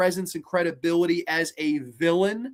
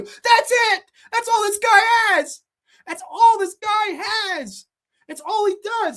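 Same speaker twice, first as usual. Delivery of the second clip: high mocking sneer; 13 semitones higher, 4 dB louder than usual. Real, not acted.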